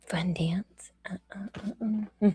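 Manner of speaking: words fade into a breathy whisper. Real, not acted. Streamer drops to a whisper for her followers. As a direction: drop to a whisper at the end.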